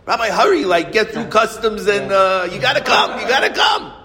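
a student bursting to drag the rabbi through customs; excited student. Retold or imitated. Student at the airport urging the rabbi to hurry, excited. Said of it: "he's very excited".

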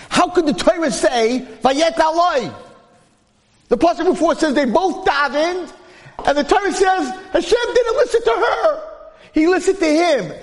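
preacher leaping high in mock disbelief at the verse; indignant exclaiming. Real, not acted. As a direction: throw the question up at the crowd in disbelief. Preacher exclaims his outrage.

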